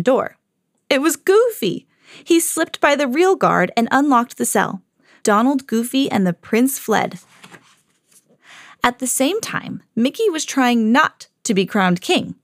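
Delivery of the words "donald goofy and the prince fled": usual speaking voice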